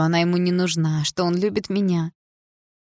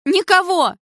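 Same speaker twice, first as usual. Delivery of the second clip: much higher, loud shrieking denial; mother shrieking back at the stepfather; loud hysterical reply; 11 semitones higher, 6 dB louder than usual. A narrator's reading. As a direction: scream it back, near hysterics. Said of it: "так же громко отвечала мама".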